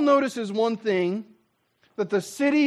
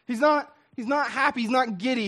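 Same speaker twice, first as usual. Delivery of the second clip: ordinary talk, nothing marked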